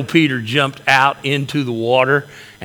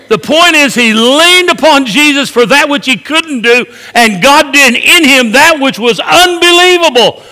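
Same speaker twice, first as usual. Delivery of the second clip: loud, higher fiery preaching voice; preaching at full force; 12 semitones higher, 12 dB louder than usual. Acted. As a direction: preach it out to the whole church. Climax of the sermon.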